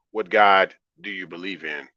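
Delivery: usual speaking voice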